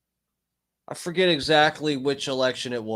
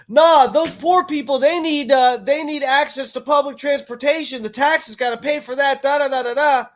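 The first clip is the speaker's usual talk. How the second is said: high, loud mock preachy voice; mocking the lady in line; 9 semitones higher, 5 dB louder than usual. Mocking a woman lecturing strangers in line, high and loud. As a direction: ham up a self-righteous voter lecturing the line.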